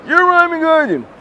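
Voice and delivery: high, excited put-on fan voice; imitating a fan. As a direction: squeal it like a fan spotting you. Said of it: "pop in and be like".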